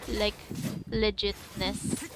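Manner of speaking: normal talking voice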